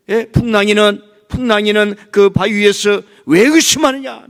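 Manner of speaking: high, loud, driving sermon cry; full-force preaching. Acted. Preacher to the congregation about Jesus' rebuke, full-force preaching. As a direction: preach it out at the top of the voice.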